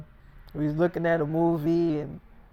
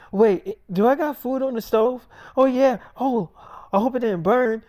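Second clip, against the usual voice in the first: higher and louder, suddenly remembering; sudden alarm; 6 semitones higher, 5 dB louder than usual. Retold or imitated. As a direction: jolt up, worried about the stove. Girlfriend remembers food on the stove.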